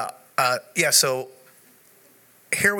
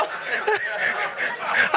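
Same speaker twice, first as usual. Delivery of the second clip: high breathless laughter. Real, not acted. Still laughing at the joke.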